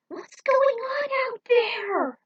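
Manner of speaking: high, loud, slow scared cry; calling out in alarm; frightened shout over battle noise. Acted. Scared character hears the fight.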